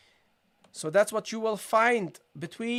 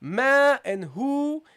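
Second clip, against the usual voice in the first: slow, stretched "Ma" and "hu"; equal long vowels; 4 semitones higher, 4 dB louder, much slower than usual. Shown as a demonstration. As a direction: hold "Ma" and "hu" for the same length. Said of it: "the duration should be identical".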